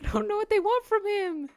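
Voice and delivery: high, fast put-on character voice; a nervous little voice blurted out quickly. Same character voice repeated.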